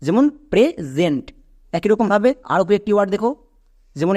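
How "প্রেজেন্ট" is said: "প্রেজেন্ট" slowly, with a buzzed z in the middle; s voiced as z. Shown as a demonstration. Teacher gives an example of the rule, voiced s. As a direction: split it as প্রে, জেন্ট, voiced z. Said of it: "এসটা এখানে বর্গীয় জ উচ্চারণ দেবে".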